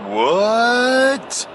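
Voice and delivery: high stretched-out disbelieving what; incredulous what. Retold or imitated. To the inspector, a high stretched stunned what. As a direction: act floored by the news. Retold baffled reaction to inspector.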